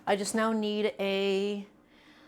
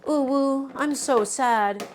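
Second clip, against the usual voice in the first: louder, slow fake whimper; mock sadness; 5 semitones higher, 4 dB louder, much slower than usual. Streamer fishing for pity subs from chat, mock sad whimper. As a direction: fake a pitiful pout. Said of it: "can't even pretend to be an e-girl".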